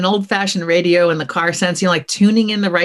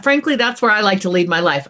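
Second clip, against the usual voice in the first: normal talking voice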